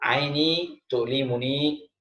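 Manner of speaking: "Aini tu'limuni" drawn out very slowly; very slow Arabic demo. Shown as a demonstration. Teacher models eye-pain phrase, very slowly. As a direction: stretch out "Aini tu'limuni" for students to copy.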